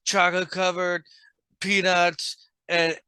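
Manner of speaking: fat, drunk rapper impression. Friend parodying rap lyrics about candy, fat drunk rapper voice. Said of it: "drunk [name] is what you sound like".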